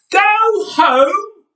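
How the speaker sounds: high loud disbelieving shout; outraged shout. A narrator's reading. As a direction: yell it back in outrage. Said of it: "he shouted".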